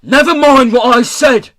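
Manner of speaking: very high, loud screech; furious scream. A narrator's reading. Pirate boss silencing the guard, screaming. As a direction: scream over the objection. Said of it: "screamed Peavy".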